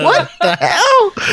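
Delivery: high, slow words through helpless laughter; laughing disbelief. Real, not acted. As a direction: crack up while asking in disbelief. Cracking up at botched accent.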